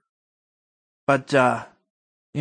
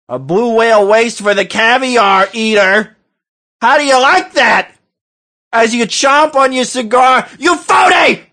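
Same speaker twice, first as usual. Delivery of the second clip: rant building to a shout; 5 semitones higher than usual. Acted.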